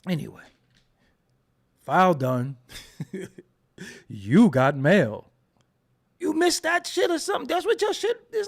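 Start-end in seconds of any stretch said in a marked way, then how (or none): 1.8-2.6 low, flat robotic announcement; AOL computer voice. Retold or imitated. Streamer teasing a dial-up viewer, low robotic computer voice. 4.1-5.3 low, slow robotic announcer; AOL mail voice. Retold or imitated. Streamer mocking a slow-internet viewer, low slow robotic voice.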